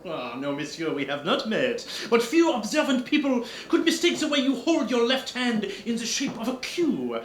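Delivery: lightly hammed French detective, pleased with himself; French accent. Detective showing off to the countess, French accent. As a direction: lay out the deduction with Gallic flair.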